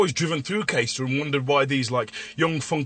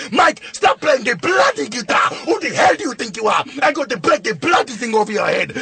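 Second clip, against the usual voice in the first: mock angry boss; 6 semitones higher than usual. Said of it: "here's Derek Chinnery".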